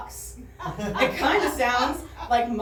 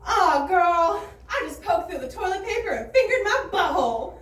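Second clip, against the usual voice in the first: high, breezy gossip voice; casual bathroom caller; 6 semitones higher than usual. Retold or imitated. Woman on her phone telling a friend, breezy and high. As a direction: chat like a carefree girlfriend on speakerphone. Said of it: "She goes".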